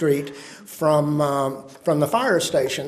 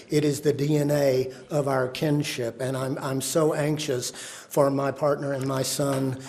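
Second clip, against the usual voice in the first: usual speaking voice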